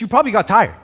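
ordinary delivery

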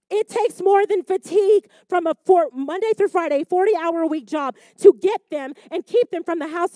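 loud, high preaching; preaching at full force. Acted. Preacher to congregation, loud full-force preaching. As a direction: drive the point home, loud and high.

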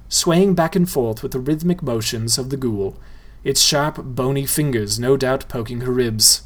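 usual speaking voice

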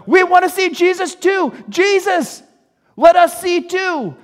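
high, loud pleading shouts; crying out. Acted. Blind men answering the crowd, crying out to Jesus. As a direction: yell desperately to be let through. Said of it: "the blind people are going".

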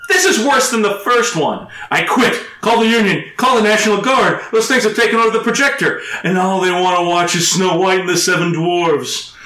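panicked mock rant. Quoting a panicked projectionist.

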